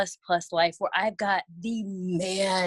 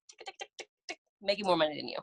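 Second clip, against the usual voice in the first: soft whispered tick tick, then a fast quiet gloat; ticking sound; 3 semitones lower, 7 dB quieter, much faster than usual. Retold or imitated. Stay-at-home mom quietly gloats about her earnings to a critic. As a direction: tap out the clock, then rush the boast.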